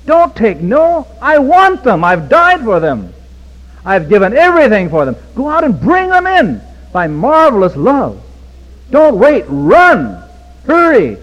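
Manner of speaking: high, loud pleading command from the master; urgent command. Acted. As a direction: cry out like a master sending servants. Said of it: "he says in the third call".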